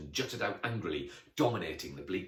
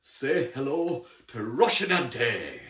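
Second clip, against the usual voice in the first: grudging, sneering introduction; grim sarcasm; 3 semitones higher, 6 dB louder than usual. Acted. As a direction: introduce the rival with sour grandeur, slowing on the name. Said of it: "said grimly".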